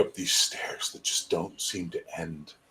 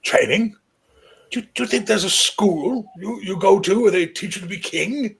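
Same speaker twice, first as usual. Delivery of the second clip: put-on high, loud old king's voice; 11 semitones higher, 5 dB louder than usual. Old king summoning the young high king closer. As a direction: croak it out like an ancient ruler.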